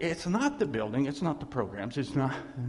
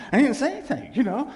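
emphatic repeat; 8 semitones higher, 7 dB louder than usual. Real, not acted. Self-mocking emphatic repeat.

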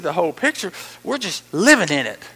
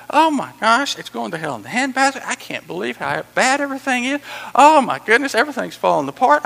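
higher, exclaimed fretting over the world; mock dismay; 4 semitones higher, 4 dB louder than usual. Preacher mimicking people fretting over the world, mock dismay. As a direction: wail it in exaggerated alarm. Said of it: "see the world and you go".

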